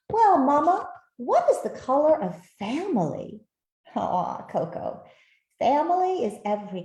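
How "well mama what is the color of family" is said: put-on high, loud little-girl voice asking Mama; lightly voiced eager child; child's question. Coco the puppy asking her mother, high and eager.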